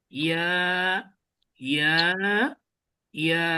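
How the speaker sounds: ইয়া stretched long and even; drawn-out recitation. Shown as a demonstration. Teacher closes the recitation, stretching each letter. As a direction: hold the vowel of ইয়া slowly. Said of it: "বাকিগুলো টেনে টেনে উচ্চারণ করছি".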